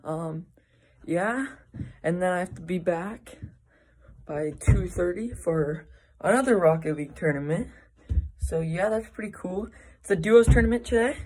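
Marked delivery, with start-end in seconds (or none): none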